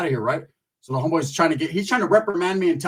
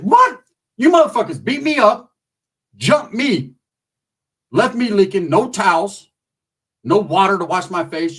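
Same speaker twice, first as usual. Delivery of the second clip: acted-out angry shouting at the group; retold angry outburst; 3 semitones higher, 6 dB louder than usual. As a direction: fire back in outrage, listing each grievance. Retold angry confrontation with homeboys.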